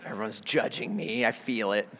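very low, soft undertone; hushed aside. Retold or imitated. Father feels the shoppers judging him, hushed and low. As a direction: say it under your breath, low.

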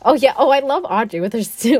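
usual speaking voice

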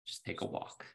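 a discreet whispered aside. Retold or imitated. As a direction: quietly tell him to step out. Assistant quietly advising him.

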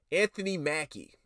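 usual speaking voice

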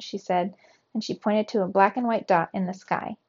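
ordinary delivery, nothing marked

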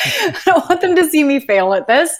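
high, loud, panicky rush; replaying her own panic before the audition; panicked. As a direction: spiral into audition dread out loud. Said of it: "I was panicking".

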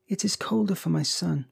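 put-on high, grieving old woman; weeping. A narrator's reading. Old woman grieving for her son, weeping. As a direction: mourn it, voice lifted and tearful. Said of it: "and wept afresh".

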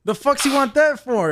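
annoyed cook snapping at the server; grumpy old cook. Retold or imitated. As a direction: gripe like an irritated old line cook. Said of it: "He's like".

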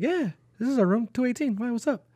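high-pitched innocent reply; high retold reply. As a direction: ask it up high, curious.

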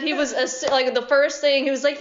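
usual speaking voice

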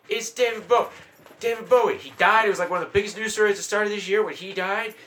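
high, loud retold protest; exasperated disbelief. Retelling his exasperation at girlfriend.

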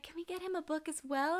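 put-on high-pitched child request; hopeful little girl. Little girl wanting a gift for Dad, hopeful. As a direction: ask like a hopeful kid.

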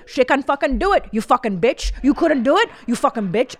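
mimicked guru berating a woman; mock abusive yelling. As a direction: sneer and shout like a cruel guru. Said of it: "he calls her a fucking bitch".

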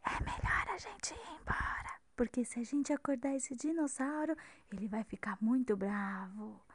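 hushed whisper, don't wake it. Pretend. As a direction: whisper like a secret warning. Warning not to wake dinosaur.

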